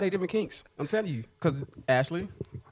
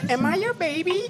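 put-on high babyish cooing voice; 6 semitones higher than usual. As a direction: coo it like a little girl. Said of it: "It was the baby talk".

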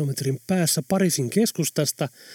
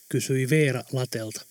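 usual speaking voice

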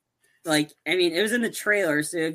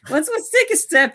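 very high, loud comic squeal; mock backing off; 13 semitones higher, 7 dB louder than usual. Pranksters easing off the public, high mock alarm. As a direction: shoot the voice up in jokey caution.

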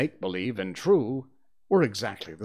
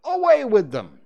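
high, loud dismissal; ringing order, building louder; imperious command; 11 semitones higher, 9 dB louder than usual. Acted. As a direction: order them off like a captain. Peter orders the brothers dragged off.

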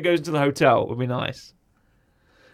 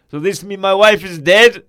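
loud, slow put-on foreign accent; Borat; 2 semitones higher, 9 dB louder, slower than usual. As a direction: ask it slowly in mock broken English. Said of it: "Borat high five".

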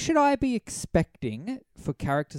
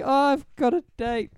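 mocking Sylvain; 12 semitones higher, slower than usual. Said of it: "he's like".

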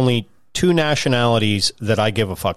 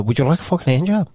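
lightly voiced Scottish woman at his usual pitch; mock Scottish offer; 3 semitones higher, faster than usual. Showing his friend the Scottish woman voice, lightly voiced at normal pitch. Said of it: "you gotta put it in more of a high pitch".

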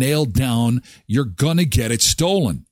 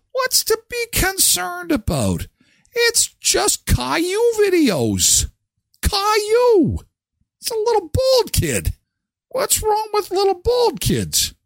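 much higher, slow faux-naive voice; mock innocent high voice. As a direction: play dumb about Caillou with sarcasm.

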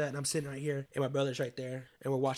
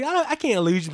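raised, loud emotional plea; retold plea before crying; 10 semitones higher, 7 dB louder than usual. Grandson pleads with grandma before the tears, loud retold plea. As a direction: re-enact pleading, higher and louder. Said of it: "Then I start crying right there".

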